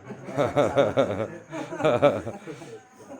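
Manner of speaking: a big hearty burst of laughter; loud laugh. Real, not acted. Healer laughs after thanks.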